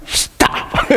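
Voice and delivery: whispered, mocking echo of dad; child's mimicking. Son onstage mimicking his dad, whispered mock warning. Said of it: "he just looked at me and went".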